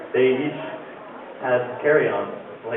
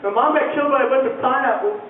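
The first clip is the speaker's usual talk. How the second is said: put-on high-pitched little girl voice; crying little girl; 8 semitones higher than usual. Crying girl answers the men who asked what's wrong, high child voice. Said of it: "see this girl crying".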